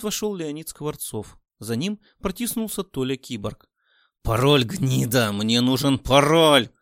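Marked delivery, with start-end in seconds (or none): 4.2-6.7 loud, slow, menacing hiss. A narrator's reading. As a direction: hiss the demand through the teeth, loudly. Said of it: "зашипел Скворцов".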